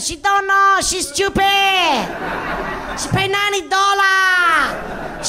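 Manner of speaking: put-on high, loud broken-English Korean accent. Masseuse mocks the client to her mate, loud Korean accent. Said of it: "saying something a bit like this to her mate".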